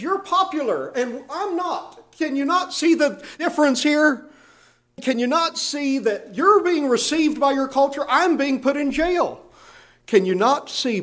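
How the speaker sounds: high-pitched, impassioned appeal; urgent fatherly entreaty; pleading like a father. Acted. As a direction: beg them to see it, voice climbing high. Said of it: "like a father would beg a beloved son".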